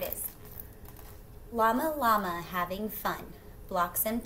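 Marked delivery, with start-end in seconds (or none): none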